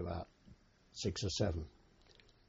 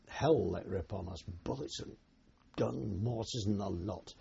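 ordinary delivery

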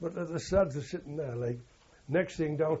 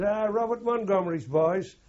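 louder, higher mock-jovial call; jovial teasing; 8 semitones higher, 7 dB louder than usual. German officer greets the prisoners, jovially teasing. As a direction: hail them like a mocking host. German jokes about the film actor.

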